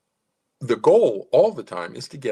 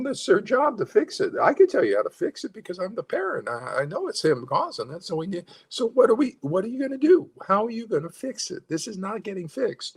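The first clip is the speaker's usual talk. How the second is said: much higher, cheerful but insistent; upbeat parent demand; 11 semitones higher than usual. Shown as a demonstration. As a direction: hand them the problem with a bright smile. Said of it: "And then you give them the problem".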